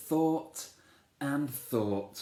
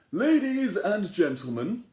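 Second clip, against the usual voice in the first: higher, louder royal proclamation; a king opening the ceremony, higher and louder; king's announcement; 10 semitones higher, 7 dB louder than usual. Acted. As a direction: address the whole square like a king. King addresses the crowd.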